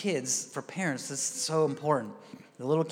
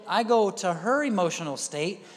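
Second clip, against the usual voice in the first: ordinary talk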